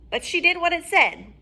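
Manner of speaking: ordinary delivery, nothing marked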